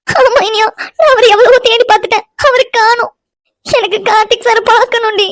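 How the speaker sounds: high-pitched tearful wail; crying. Acted. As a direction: sob the words out in distress. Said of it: "எதுக்கு இப்படி அழுதுட்டு இருக்கா". (அழுதுகொண்டே புலம்பும் குரல்)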